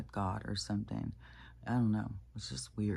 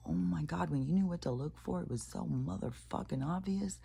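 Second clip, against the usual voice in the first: pitched up, worked-up exclamation; excited disbelief; 7 semitones higher than usual. Real, not acted. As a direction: blurt it out in amazed outrage. Realising the cheat was obvious.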